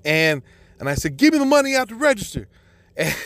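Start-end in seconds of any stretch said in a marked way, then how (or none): 1.2-2.5 high, rushed, insistent command; retold hyped-up demand. Husband ordering salon staff to pay up, retold hyped-up demand. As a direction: fire off the order, all worked up. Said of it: "I just got so hyped up".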